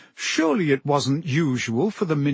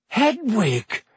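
gasped surprise; 8 semitones higher than usual. A narrator's reading. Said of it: "Harry gasped".

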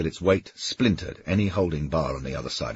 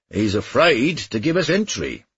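calling out; 7 semitones higher, 4 dB louder than usual. A narrator's reading. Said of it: "John Jacob called".

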